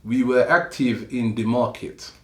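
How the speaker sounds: a hint of a French accent, measured pace; attempted French accent. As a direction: have a crappy go at a French accent. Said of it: "try to do the accent".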